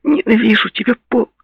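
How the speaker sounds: put-on high-pitched weepy girl's voice; tearful outburst. A narrator's reading. Weeping girl tells her ex she hates him, tearful. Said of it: "Она заплакала".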